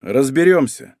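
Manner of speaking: usual speaking voice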